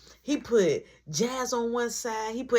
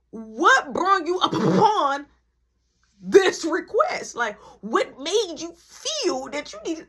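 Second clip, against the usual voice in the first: stunned slow question; 7 semitones higher, 4 dB louder, slower than usual. Real, not acted. Shocked at mom's appearance.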